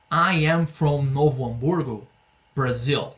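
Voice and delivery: slow, careful example answer; slow model answer. Shown as a demonstration. Teacher gives her own slow example answer. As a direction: say it slowly so they follow. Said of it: "então eu vou responder aqui".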